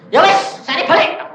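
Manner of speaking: high, loud giving in; acted-out spirit's shout; loud surrender. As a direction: cry out that you give up.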